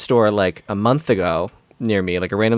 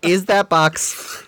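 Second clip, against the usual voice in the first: strained patience; 5 semitones higher than usual. Retold or imitated. Retelling his patient repeated question.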